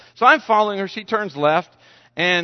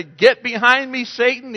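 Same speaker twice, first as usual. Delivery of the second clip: rebuking prayer; 6 semitones higher, 4 dB louder than usual. Shown as a demonstration. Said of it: "do a prayer, say".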